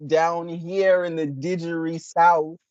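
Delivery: slow, hammed-up fake Aussie twang; mock Australian-Texan accent. Mocking his friend's botched Australian accent, slow and hammed-up. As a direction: ham up a bad outback accent. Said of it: "a horrible Texas accent".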